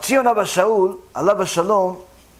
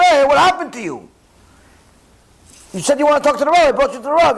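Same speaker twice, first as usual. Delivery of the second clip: louder, higher call after him; 5 semitones higher, 3 dB louder, much faster than usual. Retold or imitated. Driver calls after the young husband walking off, loudly. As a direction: shout to someone walking away. Calling after the man leaving.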